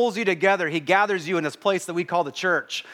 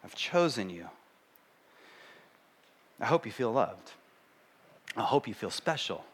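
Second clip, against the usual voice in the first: tender voice of God; 6 semitones lower, 8 dB quieter than usual. Retold or imitated. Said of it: "God is saying".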